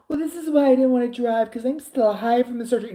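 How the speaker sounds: high-pitched mock whining; whiny excuse. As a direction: imitate a friend whining out an excuse. Retold friend's whining excuse.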